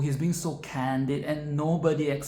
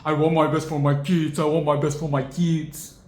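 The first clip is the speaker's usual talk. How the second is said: loud, whiny parent voice; mock an entitled parent, loudly; 5 dB louder than usual. Mocking entitled parents who want the best for their kids. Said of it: "they can be so entitled".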